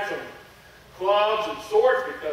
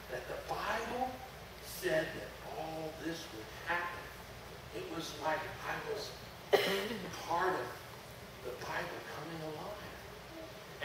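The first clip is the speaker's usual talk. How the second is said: hushed wonder; 3 semitones lower, 12 dB quieter than usual. Acted. Awed retelling.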